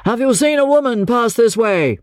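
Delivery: urgent, high-pitched enquiry; crying out. A narrator's reading. Pursuer asking a policeman about a woman, crying out. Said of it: "cried the first speaker".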